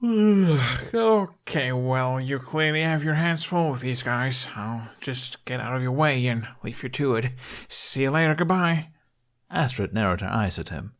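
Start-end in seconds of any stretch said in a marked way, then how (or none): 0.0-7.3 put-on higher, lighter boyish voice trying to slip away; breezy excuse, edging toward the exit; Hiccup voiced. Hiccup feigns sleepiness, escaping. 7.7-8.9 hasty goodbye. Acted. Hiccup hurrying off.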